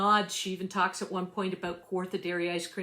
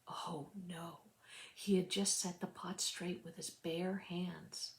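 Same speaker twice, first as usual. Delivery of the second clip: much softer, dropping to a whisper; hushed horror; 10 dB quieter than usual. A narrator's reading. Narrator realizing he burned his hands, whispered. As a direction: breathe it out in dismay.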